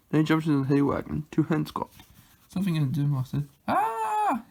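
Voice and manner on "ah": put-on high wailing baby cry; a sudden high infant wail; short high baby wail. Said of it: "It sounded like a baby crying".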